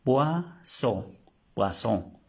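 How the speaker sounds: "Poisson" with a sharp s, "pwaso"; correct pronunciation. Shown as a demonstration. Teacher giving the right sound, crisp s. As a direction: hiss the s in "Poisson" clearly. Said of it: "Le damos el sonido como una S".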